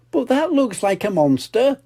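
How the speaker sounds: put-on high little girl piglet voice; lightly voiced little pig; Pepper's voice. Pepper speaking in story.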